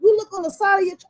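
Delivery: normal talking voice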